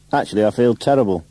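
ordinary delivery

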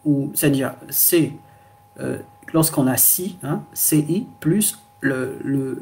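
normal talking voice

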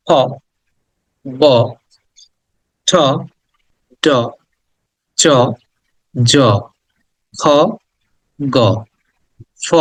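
each consonant sound said alone, slow and separate, from খ to ইয়; teacher sounding out consonants for the class; reciting consonant sounds. Shown as a demonstration. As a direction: call out each sound one by one with a pause after it. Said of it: "আমি sounds গুলো একবার করে উচ্চারণও করে দিব".

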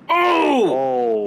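sudden high startled cry; shocked shout. Real, not acted. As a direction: yelp in shock. Ship explodes, stranded.

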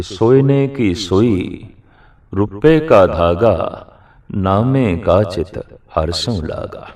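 slow, measured scripture recitation; verse recitation. Acted. Preacher quotes Namdev's verse to the congregation, slow recitation. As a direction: draw out each phrase of the sacred line.

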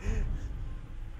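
short unvoiced laugh; quick breathy chuckle. Real, not acted. Laughs at Cait's line.

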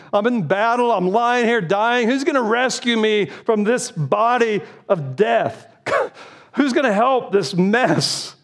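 desperate cry. Acted. Voicing dying soldier crying out.

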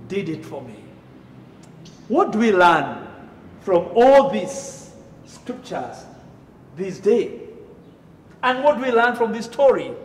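ordinary talk, nothing marked

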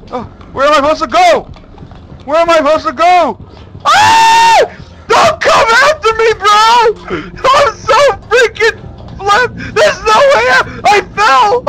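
high desperate panicked yelling; being chased. Real, not acted. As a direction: cry out in terror while fleeing. Being chased in horror game.

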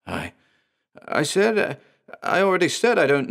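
high, halting, frightened protest; stammering. A narrator's reading. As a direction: stumble over every word. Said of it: "he stammered".

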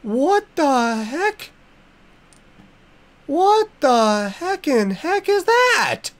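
creeped-out gasping question; alarmed disbelief. Real, not acted. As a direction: stare at the monster in disbelief. Sees creepy thing.

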